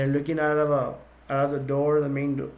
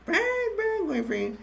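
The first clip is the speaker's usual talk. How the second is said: much higher, silly sing-song; squeaky zombie voice; slower than usual. Retold or imitated. Undead lady taunting the group, squeaky and high. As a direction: pitch it way up like a cartoon ghoul.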